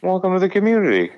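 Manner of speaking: high, sweet polite welcome; bright host greeting, sing-song; phone voice. Shown as a demonstration. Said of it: "This is my phone voice".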